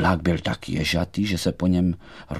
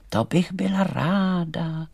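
slow, high doll voice; lightly voiced little doll; poppy doll; 8 semitones higher, slower than usual. A narrator's reading. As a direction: answer gladly, high and unhurried. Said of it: "řekla maková panenka".

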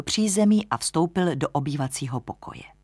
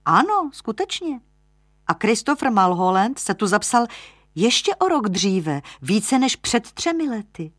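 higher, lightly amazed lodger voice; surprised young lodger; 6 semitones higher, 4 dB louder than usual. Acted. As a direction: report the discovery, pitched up. (překvapený mladík, výš)

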